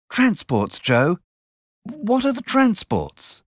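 a lightly acted puzzled child; curious boy. A narrator's reading. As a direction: Pip asking Joe a question. Pip asks Joe.

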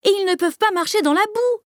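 put-on high child voice, anxious; a little girl adding her worry; girl's voice. A narrator's reading. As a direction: Sophie backing her sister, high child voice. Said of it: "ajoute Sophie".

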